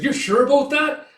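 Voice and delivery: high, loud incredulous question; acted alarmed doubt; doubting outcry. Comrade doubts the battle plan, incredulous question.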